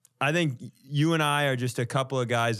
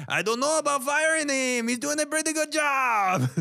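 high, fast puppet voice; Jalapeno puppet voice; 14 semitones higher than usual. Pretend. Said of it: "And then Jalapeno kicks in".

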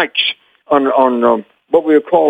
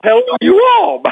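high, projected Southern greeting; drill instructor voice. Acted. Boy actor on take one told to speak up, drill instructor voice. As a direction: belt it up to a far-off microphone with a Southern twang. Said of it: "I had a drill instructor voice".

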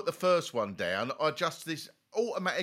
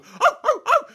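high, loud excited oh, oh; excited whoops; 10 dB louder than usual. Acted. Describing the hunting dog tearing across Portugal, loud excited whoops. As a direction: cheer the dog on as it runs.

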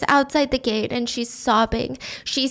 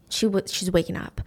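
normal talking voice

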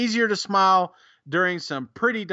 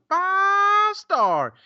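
very high, long-held "five", then a drop; over-the-top game-show score call; rating fanfare; 13 semitones higher, 4 dB louder than usual. Real, not acted. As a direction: shoot way up and hold the first word. Announces a rating with big flourish.

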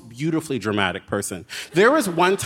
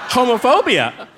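laughing exclamation; 10 semitones higher, 7 dB louder than usual. Real, not acted. Laughing loud punchline.

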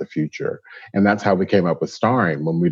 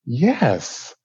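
insistent life guide; 8 semitones higher than usual. Retold or imitated. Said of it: "And she said".

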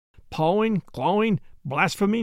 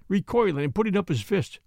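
plain reading voice

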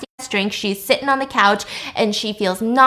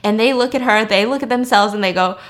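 ordinary delivery

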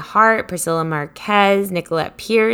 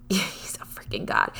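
hushed awed whisper; 9 semitones lower, 10 dB quieter than usual. Real, not acted. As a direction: whisper it like a secret. Playful awe at wise guest.

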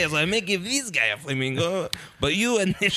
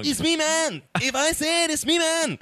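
high hammed-up Mexican accent; mock Latin rocker, pitched way up; 12 semitones higher than usual.

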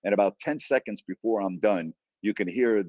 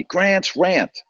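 mock yell, pitched up high; retold yell; 9 semitones higher, 8 dB louder than usual. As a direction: imitate a passer-by calling from behind. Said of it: "someone yells from behind me".

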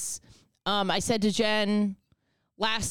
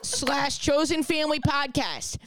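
overacted sales pitch; hammy ad read; 5 semitones higher than usual. Mock hammy podcast ad plea.

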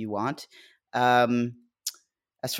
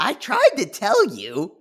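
drawn-out, higher I told you so; teasing I-told-you-so; 8 semitones higher, 4 dB louder, slower than usual. Real, not acted. Co-host to host after the Kiwi review, teasing. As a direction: say it slowly and high, rubbing it in.

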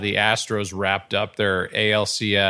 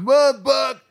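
high, strained yell from the stands; heckling fan yelling; 13 semitones higher, 5 dB louder than usual. Retold or imitated. Fan yelling at the announcer from the stands, high strained yell. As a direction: holler it like a rowdy baseball fan. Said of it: "yelling the whole time".

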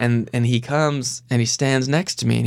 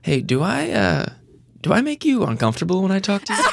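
tentative, higher-pitched quote of another man; lightly voiced hesitant question, pitched up; imitating gym acquaintance; 8 semitones higher than usual. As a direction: act out his awkward question without the accent. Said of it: "I can't do the accent, but he goes".

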